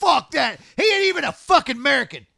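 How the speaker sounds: loud, high, fast protest; indignant outburst. Real, not acted. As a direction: reject it in real outrage. Outrage at the casting choice.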